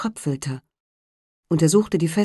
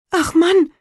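high, loud cry of a worried wife; alarmed wife; 13 semitones higher, 6 dB louder than usual. A narrator's reading. Wife crying out to her husband, high and loud. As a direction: call out in dismay.

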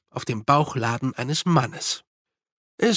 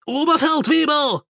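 high, alarmed call; urgent, high-pitched character shout, not at full volume; 12 semitones higher than usual. A narrator's reading. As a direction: cry out to someone walking away. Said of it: "rief er".

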